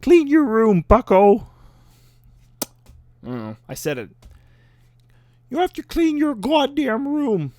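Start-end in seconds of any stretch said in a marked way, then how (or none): 0.0-1.5 higher, louder put-on lecturer voice; Jordan Peterson voice. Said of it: "in my Jordan Peterson voice". 5.5-7.5 much higher put-on lecturer voice; Jordan Peterson voice. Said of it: "in my Jordan Peterson voice".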